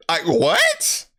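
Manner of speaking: very high-pitched what; incredulous. Real, not acted. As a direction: shoot up high in disbelief. Incredulous reaction to her staring.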